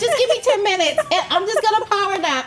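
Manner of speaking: imitating a friend. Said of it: "she'll be like".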